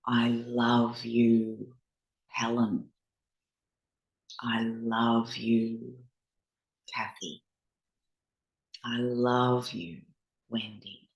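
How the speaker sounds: gentle self-kindness, fading out; soothing. Shown as a demonstration. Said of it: "repeat some soothing words to yourself".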